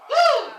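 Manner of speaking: high, loud "woo"; exclaimed whoop. Real, not acted. Preacher moved mid sermon, loud high whoop. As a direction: let out a sharp, high whoop.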